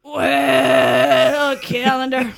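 loud, drawn-out hesitant "well"; booming, stalling start; big-breath windup. Acted. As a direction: launch it after a huge breath. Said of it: "took a big, deep breath".

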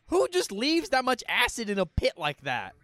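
loud, high-pitched disbelief; incredulous outburst. Real, not acted. Host seeing the acid pit, incredulous. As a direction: demand it of the screen, high and loud.

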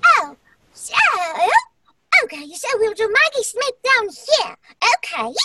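put-on very high four-year-old version of the actress; little-girl take on a grand actress, very high; Maggie Smith. Shown as a demonstration. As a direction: pitch it way up into a tiny child. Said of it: "but she's four years old".

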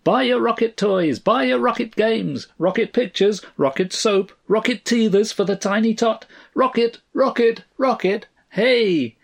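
high, singsong street vendor's patter; raucous hawker. A narrator's reading. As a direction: hawk the wares in a high, ringing cry. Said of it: "faint and raucous declarations of the vendors and hawkers".

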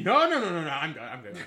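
hammed-up scared patient begging off; mock panicked refusal. Acting patient refusing scary doctor.

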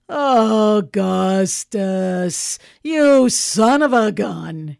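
big, loud, drawn-out hail; hearty greeting. Acted. Man turning round to greet Popper, loud and hearty. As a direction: hail an old pal at full volume.

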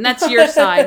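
hammed-up childish squabble; mock bickering shout. Petty argument over sides acted.

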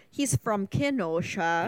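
slow, drawn-out Kenosha accent on purpose; deliberately exaggerated Kenosha accent; thick Kenosha accent. Shown as a demonstration. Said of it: "that was thick".

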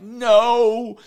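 sudden high, loud cry of no; a sharp, high alarmed shout. Retold or imitated. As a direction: yell it to stop the dog in its tracks. Yelling at the dog to stop.

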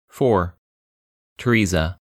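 ordinary delivery

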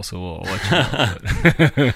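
slow, laughing through the words. Real, not acted. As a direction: let the laugh carry it out. Laughing while wrapping up.

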